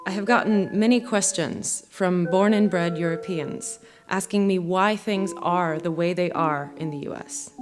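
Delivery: normal talking voice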